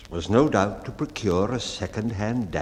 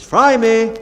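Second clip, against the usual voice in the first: much higher, much louder shout; loud cry; 12 semitones higher, 11 dB louder than usual. A narrator's reading. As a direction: yell it like a name across the ship. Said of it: "such as".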